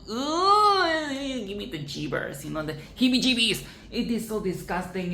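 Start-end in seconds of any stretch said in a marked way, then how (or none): 0.1-2.4 high oozing creepy voice; slimy villain. Retold or imitated. Imitating the greedy toy collector, high creepy ooze. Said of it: "the way he talks is so".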